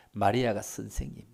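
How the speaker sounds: ordinary talk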